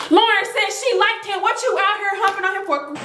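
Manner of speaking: high, fast accusing. Retold or imitated. Mika accusing Cece over Lauren's crush, high quick accusation. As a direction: throw it in her face, high and quick. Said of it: "she was like".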